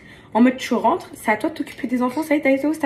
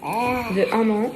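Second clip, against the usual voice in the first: laughing; much slower than usual. Real, not acted. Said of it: "Je mange mon dernier repas".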